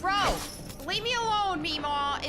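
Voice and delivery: loud, high plea to be left alone; panicked yell. Real, not acted. Gamer fleeing Meemaw, loud high panicked yell. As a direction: shout her off in a panic. Chased by enemy.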